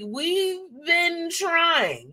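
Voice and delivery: sarcastic, dragged-out reply to nosy people; snarky. Retold or imitated. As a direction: stretch it out, fed up with being asked. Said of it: "a little bit more snarky with my responses".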